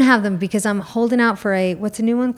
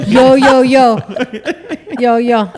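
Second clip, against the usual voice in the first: mock Italian-American tough guy.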